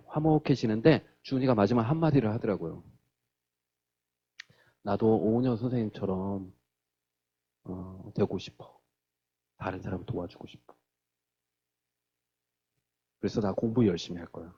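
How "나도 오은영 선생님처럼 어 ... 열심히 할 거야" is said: put-on low, soft, halting child's resolve; retold quiet wish. Child confiding a dream after the show, retold quiet resolve. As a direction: share it gently, pausing between thoughts.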